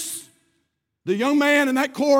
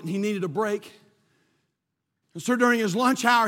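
ordinary talk

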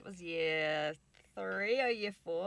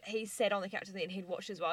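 ordinary talk

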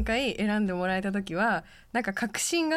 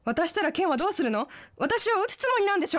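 suspicious panic; 9 semitones higher, faster than usual. Acted. Drama: suspects Ken will shoot.

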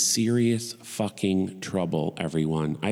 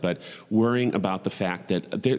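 ordinary talk, nothing marked

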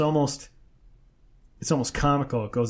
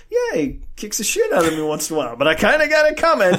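cheery confession; 5 semitones higher, 5 dB louder, faster than usual. Retold or imitated. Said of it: "it turned into".

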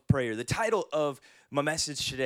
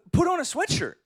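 mock bossy wife; 13 semitones higher than usual. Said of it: "She goes".

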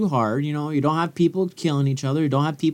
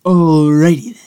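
Jim Carrey impression; 7 dB louder than usual. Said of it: "I just took that from Jim Carrey".

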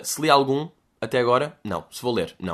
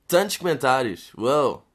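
usual speaking voice

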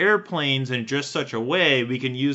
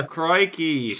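Steve Irwin; 4 semitones higher than usual. Acted. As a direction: toss it out in a bad Aussie accent. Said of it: "just a stereotypical Australian thing".

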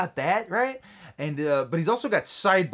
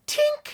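wink sound; 4 dB louder than usual. Retold or imitated. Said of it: "he goes".

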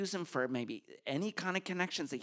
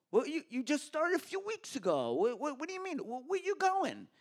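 put-on thick Long Island accent; 9 semitones higher than usual. Boss greeting his new hire in a thick Long Island accent. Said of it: "this thick Long Island accent".

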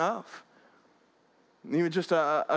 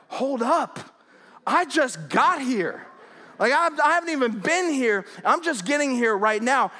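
higher, heated self-defence; indignant protest; 6 semitones higher, 3 dB louder than usual. Retold or imitated. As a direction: push back, voice jumping up. Retelling hotel quarrel, protesting back.

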